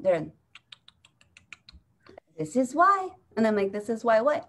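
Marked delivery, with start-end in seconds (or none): 2.4-3.1 raised, declaring pitch; pointed, high mock verdict; African elders quoted. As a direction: imitate an elder making a pronouncement.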